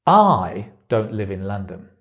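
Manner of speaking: heavy stress on "I", the rest falling away. Shown as a demonstration. Teacher showing how stress changes meaning, "I" stressed. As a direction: punch "I", then drop the rest. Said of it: "where you put the stress changes the meaning".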